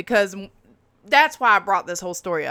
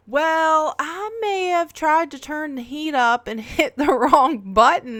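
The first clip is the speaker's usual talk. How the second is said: sheepish mom owning up in a higher voice, a laugh slipping in; sheepish mom confession; 8 semitones higher, 4 dB louder than usual. Retold or imitated. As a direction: mom admitting the slip in a higher voice. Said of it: "she goes".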